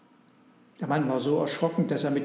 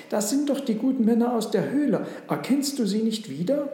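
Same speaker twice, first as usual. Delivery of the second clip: high, louder burst of recognition; exclaiming; 8 semitones higher, 4 dB louder than usual. A narrator's reading. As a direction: exclaim it to the husband, pitch lifted. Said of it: "rief die Frau aus". (aufgeregt ausrufend)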